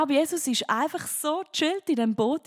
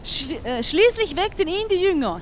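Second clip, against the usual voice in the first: dramatic Bible reading; 4 semitones higher, slower than usual. Acted. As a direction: storm story read with rising force, slow at first. Reads Bible storm story aloud.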